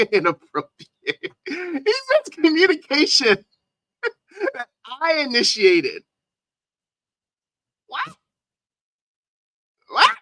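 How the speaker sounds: high, loud laughter through the words; can barely get it out for laughing; incredulous laughing. Real, not acted. Reacting to the lawyer's letter, laughing in disbelief.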